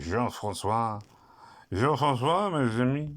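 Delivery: badly faked French character voice; bad French accent. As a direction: put on a silly, over-the-top French accent. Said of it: "I do a really bad impression of a French person".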